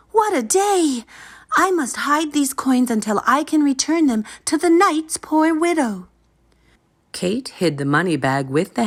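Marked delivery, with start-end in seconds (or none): 0.1-6.1 put-on high, relieved girl's voice; Kate's voice. A narrator's reading. Kate safely home after the climb, high and relieved. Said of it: "said Kate".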